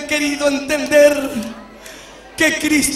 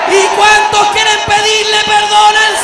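higher, louder rousing appeal; altar call; 6 semitones higher, 7 dB louder than usual. Acted. Preacher invites the congregation to ask forgiveness, loud rousing appeal. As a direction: call the congregation forward with full force.

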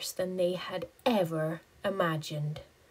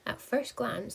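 plain reading voice

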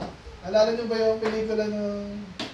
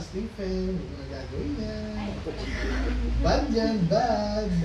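mock gentle parent; 3 semitones lower, 5 dB quieter than usual. Mimics soft modern parent scolding.

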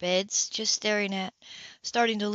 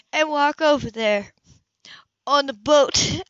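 higher, louder anxious protest; nervous disbelief; 6 semitones higher, 8 dB louder than usual. A narrator's reading. Woman on a sailboat protesting nervously to Philip. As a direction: voice her fear of the boat.

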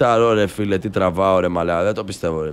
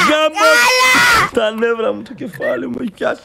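explosive high yell of rage, much louder; furious shout; 12 semitones higher, 3 dB louder, slower than usual. Real, not acted. Streamer snapping at the chaotic household, furious shout. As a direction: blow up at the noisy house.

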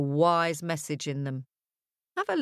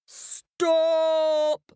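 loud high commanding roar; lion's roar; 9 semitones higher, 5 dB louder than usual. A narrator's reading. Majestic lion roaring at the stampeding animals, loud command. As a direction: roar it to halt them. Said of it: "he roared".

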